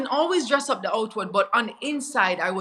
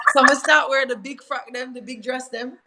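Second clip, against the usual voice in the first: Jamaican patois, a bit louder; 3 semitones higher, 3 dB louder than usual. Real, not acted. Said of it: "my Jamaican accent coming out".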